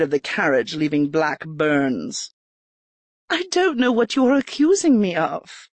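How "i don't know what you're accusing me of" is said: put-on higher young lady's voice; Erica, feigned innocence. A narrator's reading. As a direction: play innocent, nervously. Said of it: "Erica feigns innocence".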